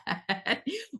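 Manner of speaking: bright high laugh at her own joke; a quick burst of real laughter. Real, not acted.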